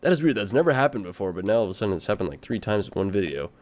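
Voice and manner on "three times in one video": ordinary talk, nothing marked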